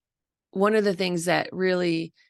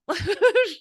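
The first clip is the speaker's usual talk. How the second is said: laugh; 13 semitones higher, 4 dB louder than usual. Real, not acted. Laughing at the Goldilocks line.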